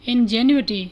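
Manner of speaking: ordinary talk, nothing marked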